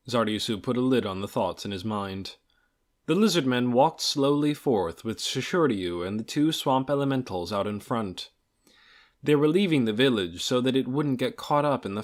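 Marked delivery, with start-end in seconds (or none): none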